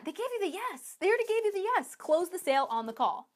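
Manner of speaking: exasperated insistence. Real, not acted. Coach to sellers who failed to close, high exasperation. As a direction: plead the obvious in a high, exasperated voice.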